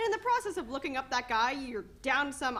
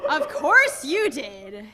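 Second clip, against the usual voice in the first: bitter; 8 dB louder, much slower than usual. Acted. Bitter reply.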